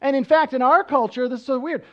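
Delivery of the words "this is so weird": ordinary talk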